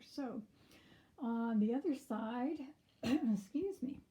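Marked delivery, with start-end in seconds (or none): 2.8-4.0 slow, hoarse after throat clearing. Real, not acted. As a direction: voice giving out. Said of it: "I'm losing my voice".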